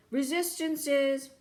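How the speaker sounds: slow, loud drawn-out bellow. Acted. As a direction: fill the lungs and bellow it out. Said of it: "He filled his lungs and bellowed".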